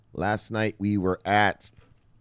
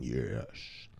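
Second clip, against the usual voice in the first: normal talking voice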